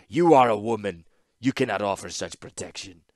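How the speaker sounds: loud, heavy-voiced warlord; Falaji chief. Falaji leader rebuffing Ashnod, heavy contemptuous voice. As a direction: dismiss her with contempt.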